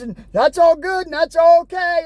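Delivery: ordinary talk, nothing marked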